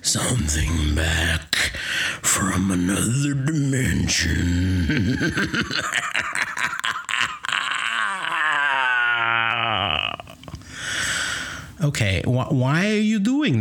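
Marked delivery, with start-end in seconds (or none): 0.0-5.2 low, slow, creepy demon murmur. Acted. Possessed by demon, creepy answer. 5.2-11.8 long demonic cackle swelling to a cry. Acted. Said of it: "just cackling".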